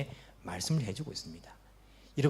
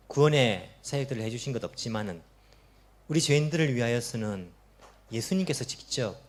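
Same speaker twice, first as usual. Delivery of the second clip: closing prayer led by the pastor; pastoral prayer; 4 semitones lower than usual. Acted. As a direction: pray humbly before God.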